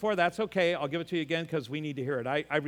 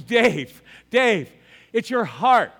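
louder, slow, stern doctor voice; doctor's warning; 6 semitones higher, 12 dB louder, much slower than usual. Retold or imitated. Doctor warns Dave about his heart, loud and slow. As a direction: drag it out like a worried doctor.

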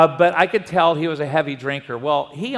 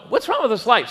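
higher, puzzled complaint; baffled at the dead flashlight; puzzled frustration; 6 semitones higher, faster than usual. Retold or imitated. Pastor puzzling over his flashlight, higher and puzzled.

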